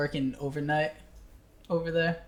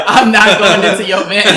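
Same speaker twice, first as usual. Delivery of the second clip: loud, higher, through real laughter; laughing refusal; 4 semitones higher, 13 dB louder than usual. Real, not acted. Repeating to friends his refusal at the van, laughing hard.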